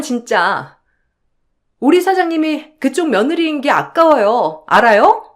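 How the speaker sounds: outraged staffer arguing in lively bursts; exasperated and combative; fed-up, indignant employee. Acted. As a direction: snap back in defence of the boss. Employee defends the boss.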